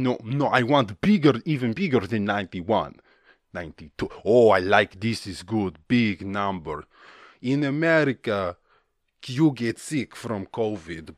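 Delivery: put-on comic Russian accent, broken English. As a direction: play a Russian official in a thick accent. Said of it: "I got carried away with the accent".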